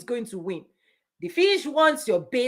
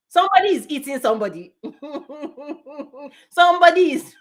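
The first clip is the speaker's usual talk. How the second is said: fast, laughing punchline; 3 semitones higher, 4 dB louder, faster than usual. Real, not acted. Speaker laughing at her own punchline, fast. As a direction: laugh through the line.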